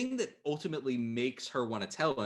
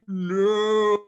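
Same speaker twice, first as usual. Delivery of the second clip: loud, high, drawn-out No; hammed-up cry of despair as the detective; mock anguished cry; 8 semitones higher, 8 dB louder than usual. Voicing Nick's anguished reaction.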